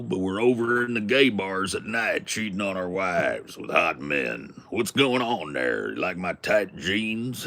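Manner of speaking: broad, hammed-up Texas twang; Texas accent. Shown as a demonstration. Showing off a Texan greeting folks, broad Texas accent. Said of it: "And I do a Texas accent".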